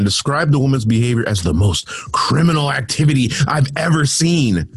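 normal talking voice

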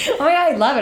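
high and loud delight; excited. Real, not acted. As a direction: squeal with joy. Thrilled by mock compliments.

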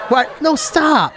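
normal talking voice

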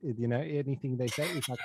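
ordinary delivery

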